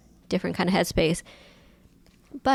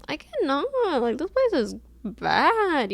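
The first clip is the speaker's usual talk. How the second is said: exasperated whine; 6 semitones higher than usual. Retold or imitated. Acting out dread of last shifts.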